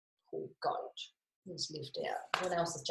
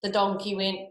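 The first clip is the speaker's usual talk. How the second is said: plain reading voice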